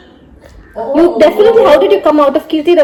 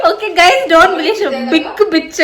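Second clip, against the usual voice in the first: usual speaking voice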